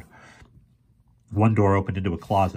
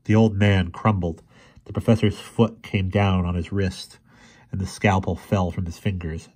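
usual speaking voice

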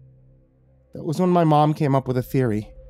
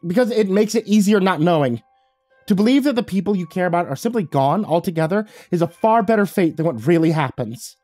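higher, sharp retort; spat back; 8 semitones higher, 4 dB louder than usual. Acted. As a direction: snap it back defensively. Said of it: "Olivia spat back".

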